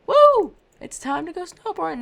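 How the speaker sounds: high, loud whoop of joy; mock excited cheer. Imagining heading out snowboarding, loud excited whoop. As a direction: cheer like you're off to the slopes. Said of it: "go and be like".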